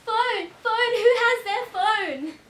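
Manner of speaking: loud, high, insistent call; urgent shouting. Acted. Crash survivor calling to the others for a phone, urgent shout. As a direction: shout it out, stretching the question. Calls out again after the crash.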